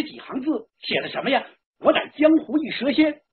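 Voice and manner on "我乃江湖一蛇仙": loud, high, measured rhymed reading; storyteller proclaiming a mysterious note; verse recitation. A narrator's reading. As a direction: declaim the snake spirit's written verse. Reads the snake spirit's verse.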